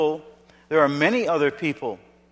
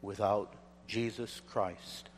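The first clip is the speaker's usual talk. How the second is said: hushed; 6 semitones lower, 16 dB quieter than usual. Acted. Quiet appeal before preaching.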